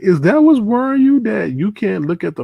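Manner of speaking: loud, high-pitched disbelief; mock incredulous. Challenging his worry about avatars, mock incredulous. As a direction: retell it as an outraged-sounding, high question.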